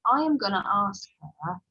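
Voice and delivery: lightly voiced indignant boy, loud and emphatic; loud, insistent retelling of a child's complaint; indignant young pupil. Boy telling his friend he'll question the author, indignant. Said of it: "I could hear one boy saying".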